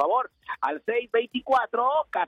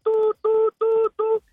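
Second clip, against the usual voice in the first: high, loud sing-song tú tú; high loud tú tú call; 10 semitones higher, 5 dB louder, slower than usual. Pretend. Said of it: "yo que siempre digo el tú".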